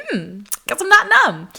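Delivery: high, loud sarcastic hmm; mock realization. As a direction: play up a wry, startled discovery. Mock realization as needle goes in.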